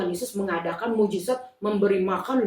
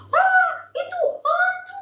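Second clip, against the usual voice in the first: very high, slow frightened shriek; shrieking fright; 5 dB louder, slower than usual. Acted. Disciples seeing a figure ahead, frightened shriek. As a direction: scream at the ghost. Disciples think it's a ghost.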